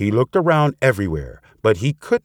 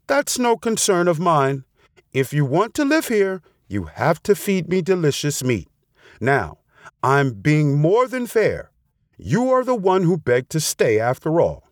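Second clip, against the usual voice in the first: lightly voiced demanding fox, pitched higher; a hint of haughty storybook fox; unsympathetic fox; 7 semitones higher than usual. A narrator's reading.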